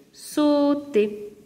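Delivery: "sauter" slowly cut into sau, ter; split into syllables. Shown as a demonstration. Teacher giving two-syllable verbs, "sauter" broken in two. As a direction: stretch "sauter" over two separate beats. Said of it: "un verbe avec deux syllabes".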